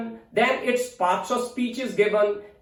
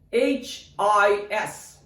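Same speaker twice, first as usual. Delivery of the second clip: slow, separate letter names; spelling out; much slower than usual. Shown as a demonstration. Teacher spelling another example for learners, spelling out slowly. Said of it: "look at another example".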